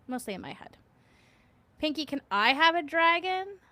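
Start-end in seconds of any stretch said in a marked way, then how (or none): 1.8-3.6 raised, louder begging voice; pleading request. Retold or imitated. Streamer voicing a chatter's plea, eager and loud. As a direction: ask like an eager kid. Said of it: "that's what Raven would like to know".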